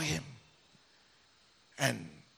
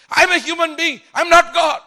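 much higher and louder, defensive outburst; loud protest; 13 semitones higher, 11 dB louder than usual. Retold or imitated. Pastor protests loudly to the Lord during the fast, near shout. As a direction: push back hard, almost shouting.

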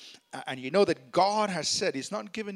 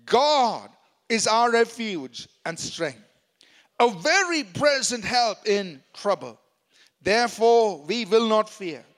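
loud reading together; 5 dB louder than usual. Shown as a demonstration. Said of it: "Let's read out loudly together".

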